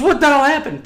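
high, loud imitated cry; startled, retold outburst; shocked demand. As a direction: demand it in mock alarm. Retold man asks after crash.

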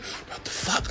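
hushed, breathy what the fuck; whispered shock. Retold or imitated. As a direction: whisper it so the clowns won't hear. Terrified retold inner thought.

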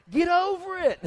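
high, loud, laughing "Get over it"; mock loud brush-off. Blunt advice mocked.

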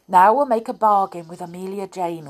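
plain reading voice

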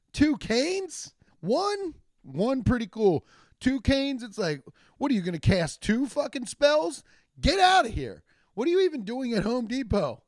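high, incredulous mockery; mocking disbelief. Comic heckling an old man with two canes, high and mocking. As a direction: tease him like it's absurd.

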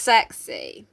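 ordinary delivery

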